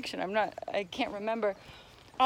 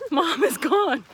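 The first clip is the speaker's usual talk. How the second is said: ordinary delivery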